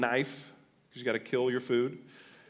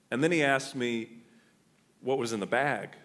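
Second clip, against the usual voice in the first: ordinary talk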